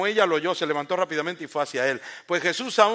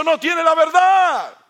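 slow, much louder and higher shouted climax; preaching shout; 13 semitones higher, 12 dB louder, much slower than usual. Acted. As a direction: drag out and shout the truth.

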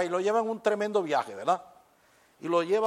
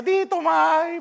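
higher, teasing mother echo; mocking mother; 11 semitones higher, 5 dB louder, much slower than usual. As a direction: mimic the kid's whine back. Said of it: "mi mamá decía". (mamá burlándose, más aguda)

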